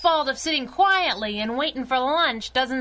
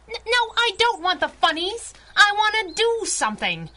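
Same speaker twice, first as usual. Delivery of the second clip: frustrated protest; 8 semitones higher, 4 dB louder than usual. Acted. Bored sidekick protesting.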